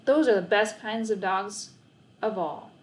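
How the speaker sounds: plain reading voice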